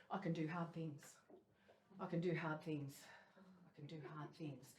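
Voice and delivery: soft, low self-talk mantra; quiet mantra. Retold or imitated. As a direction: murmur it to yourself to get through. Morning cold-water mantra, muttered.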